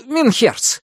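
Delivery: Минхерц said high and falling, in Menshikov's voice; Минхерц called out brightly in the character's voice. A narrator's reading. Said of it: "запыхавшийся Меньшиков". (Минхерц высоким голосом персонажа)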